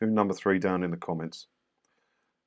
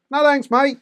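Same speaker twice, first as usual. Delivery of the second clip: put-on high, loud Scottish-accented brush-off; mock Scottish accent; 6 dB louder than usual. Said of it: "in your Scottish accent or whatever accent that was".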